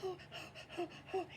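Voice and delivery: breathy high nervous oh oh oh; a flustered wolf who can't find words; nervous stammer. Acted. Wolf gasping at the prize draw, nervous high oh oh. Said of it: "little bad wolf, he's going".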